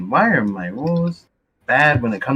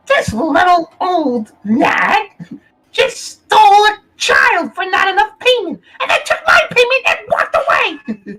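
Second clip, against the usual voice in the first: wailing shriek, much higher and louder; shrieking goblin rant; 5 dB louder than usual. Acted. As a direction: screech the accusation at the old hag with dagger out. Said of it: "point my dagger at her and go".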